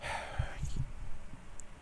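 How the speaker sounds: long breathy sigh of frustration; weary sigh. Real, not acted. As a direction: let out a tired sigh. Frustrated trader sighs.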